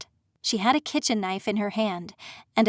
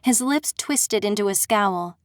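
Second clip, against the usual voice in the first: ordinary delivery, nothing marked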